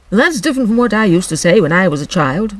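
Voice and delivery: loud, brisk creature voice; Griffin voice. Acted. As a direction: bark it out like a gruff beast.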